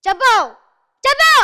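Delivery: loud, high, insistent command; demanding shout. Acted. Film teacher demanding the line, shouting. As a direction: bark at the pupil to say it. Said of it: "Prakash Raj గారిది dialogue".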